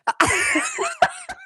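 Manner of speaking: very high shrieking giggle; high squealing laugh. Real, not acted. Vlogger losing it at the imitation, shrieking laugh.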